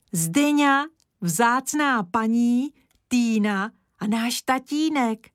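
high, slowed cries of recognition; exclaiming. A narrator's reading. Boy naming people in the picture, exclaiming. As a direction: exclaim each one you spot, drawn out. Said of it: "zvolal Kája".

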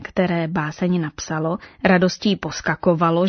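usual speaking voice

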